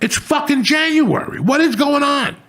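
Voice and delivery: very high, disbelieving cry; very high, disbelieving outburst about the January appointment; incredulous outrage. Real, not acted.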